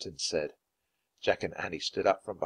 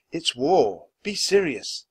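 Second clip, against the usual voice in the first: usual speaking voice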